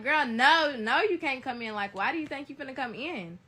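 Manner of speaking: loud yell through the door. Retold or imitated. Telling the best friend at the door she can't come in, yelling. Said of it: "So I'm yelling".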